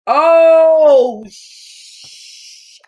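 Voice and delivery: high, stretched-out cry of shock; panicked, drawn-out, high-pitched horror; horrified disbelief. Acted. Character discovers a kidnapping at gunpoint.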